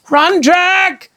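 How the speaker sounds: high, loud urgent shout. Acted. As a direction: scream a warning. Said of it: "shouted Annie".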